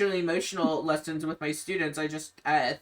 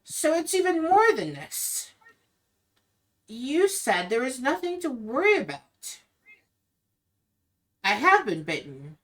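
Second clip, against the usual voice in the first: put-on higher, alarmed young woman; Irina, shocked; 8 semitones higher than usual. Irina confronts Ismark after reading she was bitten. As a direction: realisation dawning, then flat dread. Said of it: "and she goes".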